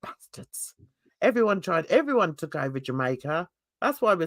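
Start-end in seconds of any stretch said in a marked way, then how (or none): 0.0-0.7 a muttered whispered swear; whispered curse. Real, not acted. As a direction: whisper it under your breath. Aside curse about colonisers.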